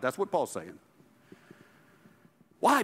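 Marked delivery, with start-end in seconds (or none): none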